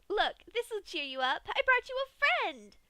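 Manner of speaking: high, loud, bubbly; giddy excitement. A narrator's reading. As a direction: show off the gift with glee. Pinkie presenting a skull gift.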